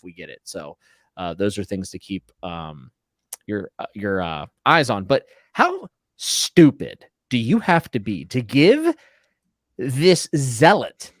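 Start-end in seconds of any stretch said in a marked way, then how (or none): 5.0-11.1 incredulous disbelief. Real, not acted. Incredulous rant about Cersei.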